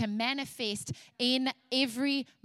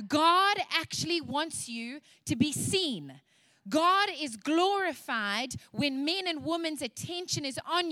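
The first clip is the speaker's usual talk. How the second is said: rising, higher-pitched preaching; full preaching; 4 semitones higher than usual. Acted. Preacher exhorting the women of God, rising higher. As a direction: build the exhortation, lifting the pitch.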